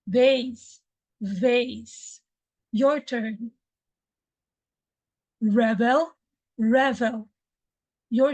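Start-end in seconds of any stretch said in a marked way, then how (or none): 0.1-2.2 Vase with a buzzing V; vase, clear V; teacher's model. Shown as a demonstration. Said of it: "I will say it first". 5.4-7.3 revel, buzzing V; teacher's model. Shown as a demonstration. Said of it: "I will say it first".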